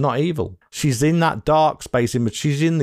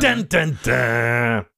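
mock dramatic sting; much slower than usual. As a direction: play up the suspense cue. Echoes the mock cliffhanger sting.

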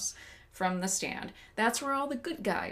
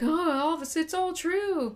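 higher, drawn-out mock awe; mock revelation; 7 semitones higher, slower than usual. Retelling the character's Damascus moment, in mock awe. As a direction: act out a sudden realization. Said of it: "he has his Damascus moment".

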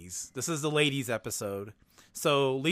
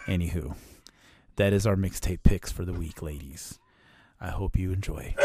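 low sexy voice; 7 semitones lower than usual. Acted. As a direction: drop low and seduce the listeners. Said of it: "I'm gonna do my low, grovelly, sexy voice".

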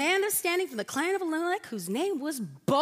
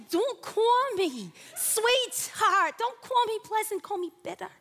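higher and very slow, dramatic novela heroine; telenovela Naomi; 6 semitones higher than usual. Acted. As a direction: draw every word out like a soap diva. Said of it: "this is how I hear Naomi say".

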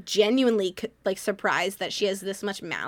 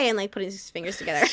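normal talking voice

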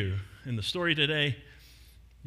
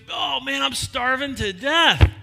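higher and louder, drawn out slowly: I'm starving; hammed-up whining shout; 9 semitones higher, 7 dB louder, slower than usual. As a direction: moan it big, like a starving fisherman. Peter complains about hunger.

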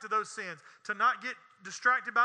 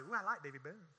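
lightly mimicked fan, much softer; 5 semitones lower, 11 dB quieter than usual. Preacher playfully mimics congregants who like the singer, lightly imitated fans. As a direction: softly gush like a fan, lower. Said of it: "Some of y'all say".

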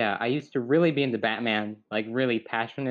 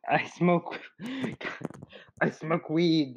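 badly faked stoner voice, pitched up with chuckles; Seth Rogen; 6 semitones higher, slower than usual. Failing the impression before a friend, higher put-on voice. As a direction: try a celebrity voice, breaking into laughs. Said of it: "That's not Seth Rogen".

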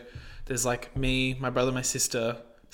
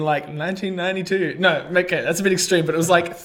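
ordinary talk

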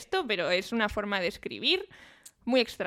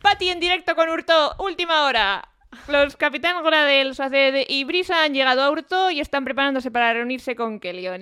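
loud breaking-news anchor voice; news reporter; 3 semitones higher, 7 dB louder than usual. Pretend. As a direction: announce it like live breaking news. Mock live news report.

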